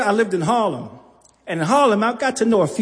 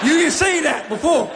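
accusing laugh; 5 semitones higher than usual. Retold or imitated. Accusing Anthony.

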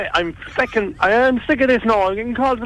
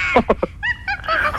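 high laugh; 3 dB quieter than usual. Acted. Prank caller laughs high.

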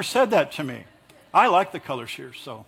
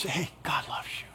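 soft unvoiced whisper; 3 semitones lower, 11 dB quieter than usual. Comforting a bummed-out brother in a whisper. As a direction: breathe it into their ear. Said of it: "you kind of whisper in their ear".

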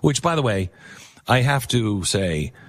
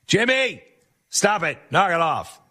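high sharp mock scolding from mom; 8 semitones higher than usual. Mom scolding her son's friend for faking Texan, high and sharp. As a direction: call him off like an exasperated mother. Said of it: "She's like".

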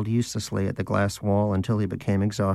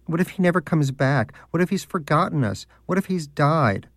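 mock worry; 6 semitones higher than usual. Mocking the doubter's fears.

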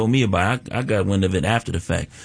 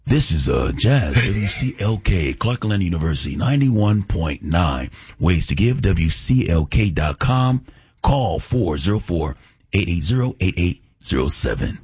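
low, slow radio announcer voice; radio announcer character; 3 semitones lower than usual. Acted. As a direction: drop into a deep, measured station ID read. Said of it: "He went straight into that character".

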